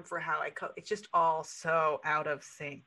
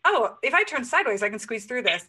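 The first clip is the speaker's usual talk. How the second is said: hopeful mock squeeze; 6 semitones higher, 9 dB louder than usual. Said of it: "you're like".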